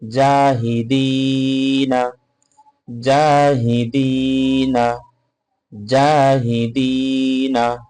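জাহিদিনা read slowly with the final না voiced; measured, melodic reading; slow spelled reading. Shown as a demonstration. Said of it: "জিম আলিফ জবর জা".

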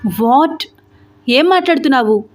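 can't believe what he hears; shocked disbelief. Acted. Boss hears of resignation.

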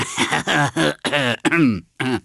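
cartoon villain chuckle; gloating laugh. Gloating laugh after victory line.

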